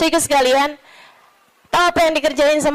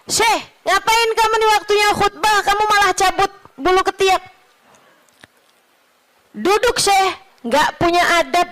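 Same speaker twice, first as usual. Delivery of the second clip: scolding rebuke; 7 semitones higher than usual. Retold or imitated. Sheikh scolds man plucking armpit.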